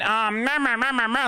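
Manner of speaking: high mocking bleats of meh. Mocking the other side.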